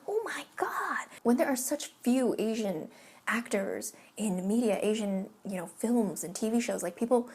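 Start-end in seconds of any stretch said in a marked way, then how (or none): 0.1-1.1 high, slow mock gasp; a hint of stunned disbelief. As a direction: drag out a stunned oh my God. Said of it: "And I'm like".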